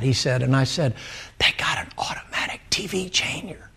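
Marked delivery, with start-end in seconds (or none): 1.4-3.7 secret whisper to dad. Retold or imitated. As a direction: whisper it in awe, not to be overheard. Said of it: "I whispered at him".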